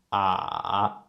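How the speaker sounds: long "ah" dipping down, then rising; falling-rising tone. Shown as a demonstration. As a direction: let "ah" sink first and then climb. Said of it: "It goes down first".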